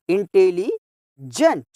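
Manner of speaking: Intelligent slowly, with a pause before gent; teacher's slow breakdown of intelligent; split into parts. Shown as a demonstration. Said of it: "टुकड़ा करके बोलेंगे".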